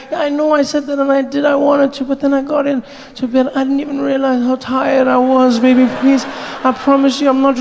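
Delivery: put-on high, earnest girlfriend voice; pleading girlfriend. Girlfriend reassuring boyfriend she is not rejecting him, high and pleading. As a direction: promise him sweetly you're just tired.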